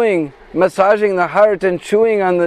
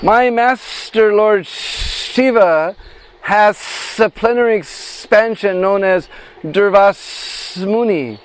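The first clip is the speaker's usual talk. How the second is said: very slow, higher serpent voice with long pauses; snake voice; 4 semitones higher, slower than usual. Acted. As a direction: draw out each phrase like the snake explaining its plan. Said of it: "imitate the voice of that snake".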